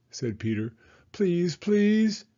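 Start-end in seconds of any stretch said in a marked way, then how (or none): 1.1-2.2 begging. A narrator's reading. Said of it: "Judy begged".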